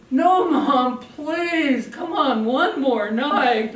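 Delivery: high, loud, slow begging; mock pleading boy. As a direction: beg like a kid. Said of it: "He's going".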